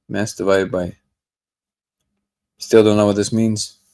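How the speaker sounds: usual speaking voice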